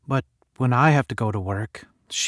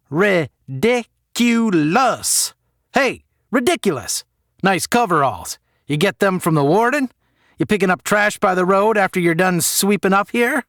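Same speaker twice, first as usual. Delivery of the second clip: a higher, sneering jeer; mock the janitor across the hall; taunting bully; 8 semitones higher, 5 dB louder than usual. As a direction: heckler taunting the janitor, lightly voiced.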